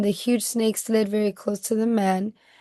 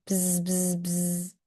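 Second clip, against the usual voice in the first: quiet bee buzz; soft buzzing; 2 semitones lower, 6 dB quieter, slower than usual. A narrator's reading. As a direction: buzz softly like a bee at his ear.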